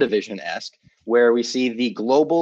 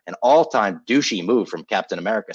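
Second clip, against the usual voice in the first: ordinary talk, nothing marked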